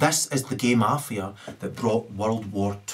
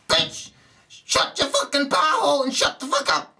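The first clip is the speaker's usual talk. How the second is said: much higher put-on comedian's yell; Richard Pryor impression. Quoting a comedian's bit, high put-on yell. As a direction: snap it out like a stand-up punchline. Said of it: "Richard Pryor".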